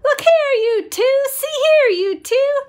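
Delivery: calling out. Acted. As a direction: call up to them, showing off.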